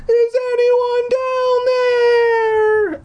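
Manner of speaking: high, loud, drawn-out scared scream; frightened scream. A narrator's reading. Eddie, calling down the empty tower, screaming in fear. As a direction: scream down the stairwell in panic. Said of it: "Eddie screamed".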